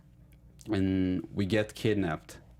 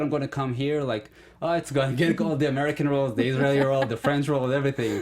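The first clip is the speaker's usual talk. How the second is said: higher, louder, rattling off big hopes; mock excitement; 6 semitones higher, 5 dB louder, faster than usual. Actor recalling his hopes before moving here, mock excitement. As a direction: play up your naive confidence. Voicing past naive hopes of stardom.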